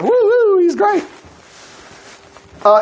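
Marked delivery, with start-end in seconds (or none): 0.0-1.0 high excited cheer; a mock cheer from the crowd, high-pitched; crowd hollering. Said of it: "everybody hollering".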